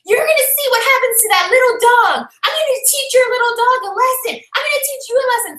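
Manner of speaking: high-pitched imitation of a ranting neighbour; screaming threats. Neighbour threatens the little dog, screaming high. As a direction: get carried away mimicking her, high and worked up. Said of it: "you're screaming so loud".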